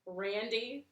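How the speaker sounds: usual speaking voice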